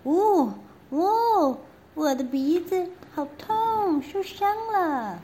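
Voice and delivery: high, whining little princess voice; whimpering princess. Acted. Princess whimpering that her nose hurts. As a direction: cry it like a hurt little girl. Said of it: "小公主说".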